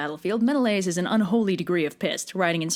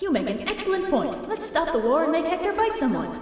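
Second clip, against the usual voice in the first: higher, breezy agreement; Athena happily agreeing with Apollo, higher voice; chirpy agreement; 7 semitones higher than usual. Acted. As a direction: cheerfully go along with it. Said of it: "And Athena's like".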